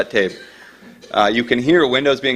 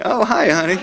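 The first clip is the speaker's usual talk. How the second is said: higher-pitched mock phone greeting; Rudy Giuliani; 10 semitones higher than usual. Politician takes wife's call mid-speech, joking imitation. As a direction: break off to greet a spouse in a put-on voice. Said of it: "so tempted to do a Rudy Giuliani".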